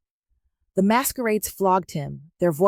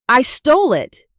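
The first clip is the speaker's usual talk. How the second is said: higher, louder anguished cry; shouted confession; 6 semitones higher, 8 dB louder than usual. A narrator's reading. Nonso confessing to the market crowd, shouting. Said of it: "he shouted".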